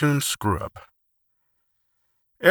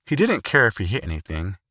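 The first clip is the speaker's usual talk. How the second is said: ordinary delivery, nothing marked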